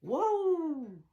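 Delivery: loud, drawn-out waouh; excited wow. Shown as a demonstration. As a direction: belt out the waouh. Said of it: "On peut dire deux waouh".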